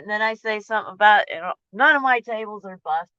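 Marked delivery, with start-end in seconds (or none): 1.7-3.1 frustrated outburst. Retold or imitated. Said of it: "so I say something about that".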